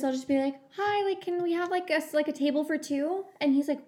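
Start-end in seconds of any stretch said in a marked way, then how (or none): 0.8-3.2 much higher, sugary hostess voice; extra nice voice. Retold or imitated. Asking the hostess for a table in an extra nice high voice. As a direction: sweeten it up for the restaurant hostess. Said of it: "just 'cause I'm being extra nice to them".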